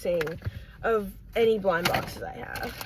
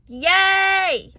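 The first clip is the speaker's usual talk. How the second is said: high, loud cheer; excited cheer; 8 semitones higher, 10 dB louder than usual. Real, not acted. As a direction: cheer with delight. Cheers at the reveal.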